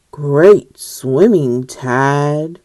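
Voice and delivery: low, loud fatherly praise; booming proud father; dad voice. Acted. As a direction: praise him in a deep dad voice.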